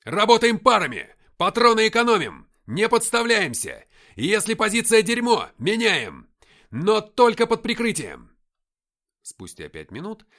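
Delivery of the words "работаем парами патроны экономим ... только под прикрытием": high, forceful battlefield commands; shouted orders. A narrator's reading. Captain instructing the platoon under fire, shouting. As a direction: bellow each order separately. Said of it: "крикнул я".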